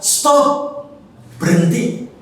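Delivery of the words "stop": high sharp cry of Stop; mock yell Stop. Mother demonstrating the shout Stop to her daughter. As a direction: snap out Stop as a warning. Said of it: "kamu harus segera teriak".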